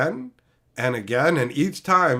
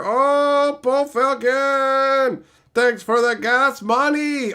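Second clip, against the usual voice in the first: excited thanks; 9 semitones higher, 4 dB louder, much slower than usual. Real, not acted. Reading out a donation.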